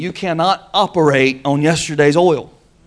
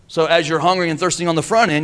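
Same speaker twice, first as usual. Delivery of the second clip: ordinary talk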